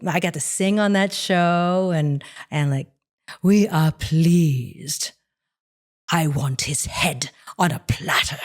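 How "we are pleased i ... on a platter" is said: slow, regal and arch villain queen; arch deep queen. Retold or imitated. Voicing the Queen of Mars, arch and deep. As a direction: lord it over them as the Queen of Mars. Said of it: "very arch and very deep".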